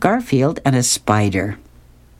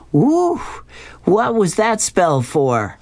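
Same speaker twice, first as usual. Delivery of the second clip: high, slow spooky spirit; 8 semitones higher, slower than usual. Acted. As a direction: open on a high "woo", then ask slowly. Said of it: "the spirit shows up from the bowl and says".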